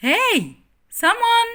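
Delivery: high loud plea; high loud pleading call. Acted. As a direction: call for help from your friends. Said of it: "Rex called".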